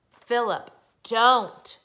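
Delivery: loud, alarmed mother's cry. A narrator's reading. Mother to son about to jump, crying out. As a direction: call out sharply to stop him. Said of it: "cried his mother".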